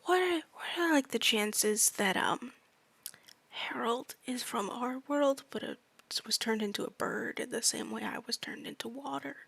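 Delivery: hushed, slow whispered aside to Harmonia. Pretend. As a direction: lean in and whisper the question. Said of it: "whisper and be like".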